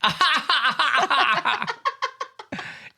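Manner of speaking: high, loud staccato cackle; a long, ringing run of ha-ha-ha; drama teacher's laugh. Acted. Friend imitates their drama teacher's laugh on request, high loud cackle. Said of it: "can you do his laugh?".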